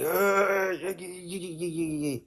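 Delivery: high-pitched, babbled string of yeahs; mock reaction. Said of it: "I was like".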